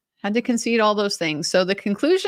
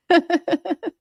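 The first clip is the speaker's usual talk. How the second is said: light laugh; 8 semitones higher than usual. Real, not acted. Laughing at polite exchange.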